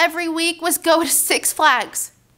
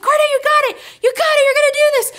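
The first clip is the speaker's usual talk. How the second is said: urgent encouragement; 12 semitones higher, 3 dB louder, much faster than usual. Retold or imitated. Friend cheering on terrified rider.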